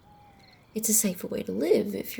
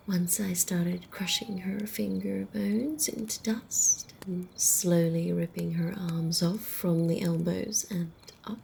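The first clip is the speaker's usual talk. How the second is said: usual speaking voice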